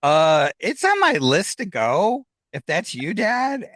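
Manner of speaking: slow, drawn-out answer into thin air, lifting at the end; talking out loud to herself. Retold or imitated. Daughter answering her dad's voice in the car, talking aloud to herself. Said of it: "I'm talking out loud to myself".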